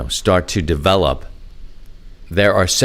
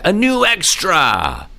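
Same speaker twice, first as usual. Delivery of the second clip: high, drawn-out cheer; excited announcement; 9 semitones higher, slower than usual. Real, not acted. Host cheering a listener's new amateur extra, excited. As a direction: celebrate it, high and stretched out. Celebrating a listener's license upgrade.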